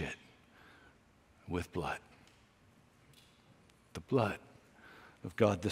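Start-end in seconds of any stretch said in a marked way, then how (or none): none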